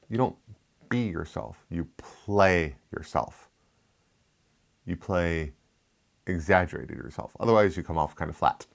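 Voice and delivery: normal talking voice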